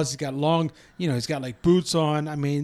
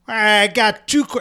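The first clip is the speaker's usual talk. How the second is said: louder Southern drawl, pitched up; imitated slow Southern twang; put-on Southern accent; 4 semitones higher, 4 dB louder, slower than usual. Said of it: "give him a Southern accent".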